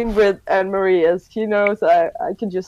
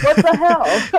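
higher-pitched indignant retort; mock disbelief; 5 semitones higher, 3 dB louder, slower than usual. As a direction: act out baffled protest. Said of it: "and I'm like".

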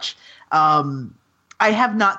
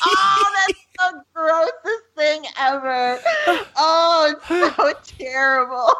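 laughing; 6 semitones higher than usual. Real, not acted. Laughing disgusted.

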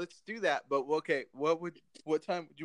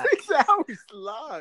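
high, slow disbelieving question; astonished; 12 semitones higher, 10 dB louder, much slower than usual. Real, not acted. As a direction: stretch it out in shock. Astonished at six hours.

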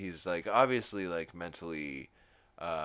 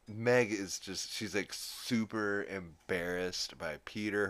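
ordinary talk

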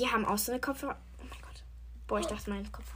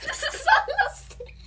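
high burst of laughter through the words; laughing; 4 dB louder, slower than usual. Real, not acted. Host cracks up at her friend's random answer, laughing. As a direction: laugh helplessly while speaking.

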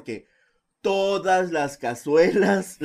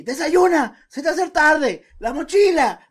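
higher, louder nagging mom listing chores; nagging mother voice; 12 semitones higher, 8 dB louder than usual. Retold or imitated. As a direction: rattle off orders like a frantic mother. Mimicking mother's morning orders. (imitando a la vecina, que le grita a Joel las tareas de la mañana con voz aguda y fuerte)

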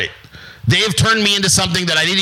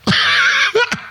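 high breathy laugh. Real, not acted. Laugh after bit.

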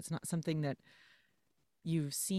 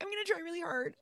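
much higher, half-crying mock whine; mock whiny; 13 semitones higher than usual. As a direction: whine it like a sulky kid. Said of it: "I'm like".

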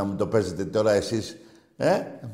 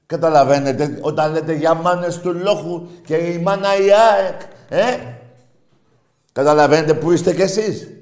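louder, scornful challenge; loud taunt; 5 semitones higher, 9 dB louder than usual. Real, not acted. Host taunting rival fans with a loud challenge. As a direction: jab at them loudly.

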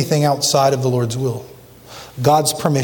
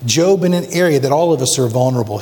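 ordinary talk, nothing marked

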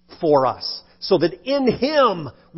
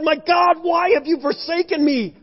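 high, pleading call to God; anguished cry; 10 semitones higher than usual. Retold or imitated. Preacher retelling his own cry to God, anguished. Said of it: "where I said".

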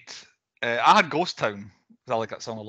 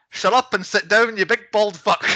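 higher, louder tuneful put-down; Cousin Avi; 7 semitones higher, 5 dB louder than usual. Retold or imitated. Podcaster quoting a favourite film entrance, tuneful loud outburst. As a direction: belt out the insult like a favourite movie line. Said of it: "so tuneful the way he's".

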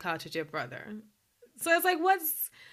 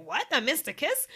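mock narrator shock; 6 semitones higher than usual. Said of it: "Melinda in the voiceover was like".

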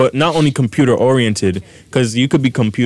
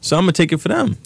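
high pitch; 7 semitones higher, faster than usual. Shown as a demonstration. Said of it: "That was me going high pitch".

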